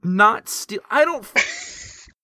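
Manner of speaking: high, loud exasperated yell; angry shout. Acted. [name] yelling at Papa Scuff, exasperated. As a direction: yell it, cracking up.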